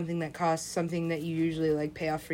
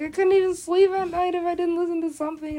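loud, high-pitched protest; exasperated complaint; 10 semitones higher, 6 dB louder than usual. Retold or imitated. Sleepless [name] listener protesting, high and exasperated.

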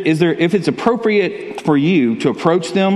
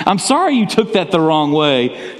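high, slow, sing-song fake apology with a laugh; mock a dismissive non-apology; 3 semitones higher than usual. Shown as a demonstration. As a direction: insincere apology, played for laughs. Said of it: "Those are not good apologies".

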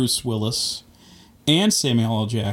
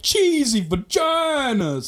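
high, slow, stretched cartoon voice; goofy NPC voice; 12 semitones higher, much slower than usual. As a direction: ham it up like a silly game pedestrian. Nadoen GTA-voetganger.

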